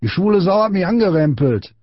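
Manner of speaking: put-on high-pitched outraged boy; indignant complaint. A narrator's reading. Small boy tattling on Friedrich, high indignant voice.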